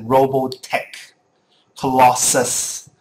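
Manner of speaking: Robotech and Colossus said slowly, each syllable clear; carefully articulated game terms; echoing his clear pronunciation. Retold or imitated. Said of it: "and stuff like you said".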